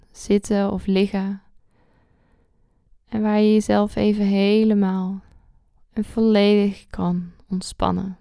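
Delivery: calm guide with long pauses; guided meditation. Acted.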